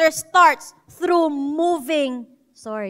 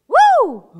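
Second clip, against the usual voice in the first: high shouted woo; loud woo; 8 semitones higher, 8 dB louder than usual. Shown as a demonstration. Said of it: "Ayos ba yun?". (malakas na sigaw na woo)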